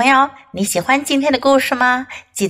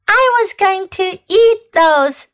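high squawky hen; squawking hen voice; 8 semitones higher, 3 dB louder than usual. Acted. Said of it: "squawked the hen".

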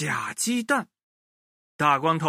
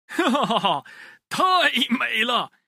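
greedy glee; 7 semitones higher than usual. Acted. Greedy woodcutter sees egg kingdom.

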